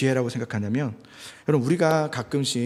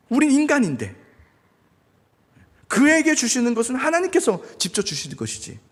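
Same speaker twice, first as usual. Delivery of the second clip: ordinary delivery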